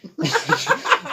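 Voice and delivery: squealing laugh; high burst of laughter. Real, not acted. Laughing at own story.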